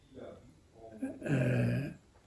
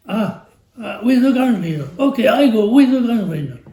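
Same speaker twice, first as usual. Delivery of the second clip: friend giving in; 7 semitones higher, 7 dB louder, faster than usual. Retold or imitated. Friend agrees, imitated.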